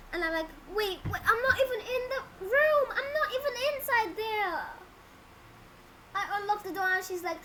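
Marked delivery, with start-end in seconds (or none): 0.7-4.7 high, raised voice of disbelief; indignant protest. Retold or imitated. Storyteller replaying the reply to the screaming woman, indignant protest. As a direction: protest your innocence in a high voice.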